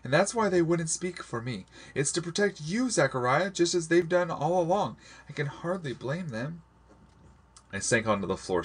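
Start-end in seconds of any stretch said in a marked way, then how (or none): 0.0-6.6 high, distraught tirade; upset, high-pitched torrent; rushing on. A narrator's reading. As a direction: keep the accusation pouring out, pitch way up. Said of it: "I rushed on".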